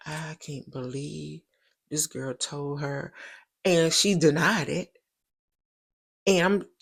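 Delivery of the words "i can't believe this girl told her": much softer, slow disbelieving murmur; stunned inner thought. Retold or imitated. The caught gossiping girl thinking to herself, slow and hushed. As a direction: voice her shocked thought under the breath.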